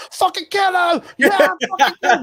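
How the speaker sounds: high, loud mock mob yell; roaring crowd shout. Arena crowd egging on the fight, bloodthirsty shout. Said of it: "and the crowd's like".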